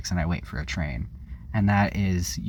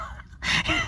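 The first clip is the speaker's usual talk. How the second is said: sudden airy burst of laughter; high breathy laugh; 4 dB quieter than usual. Real, not acted. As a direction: laugh it off in disbelief. Laughing at the police chase.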